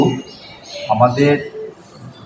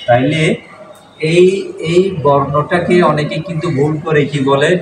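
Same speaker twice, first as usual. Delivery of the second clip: usual speaking voice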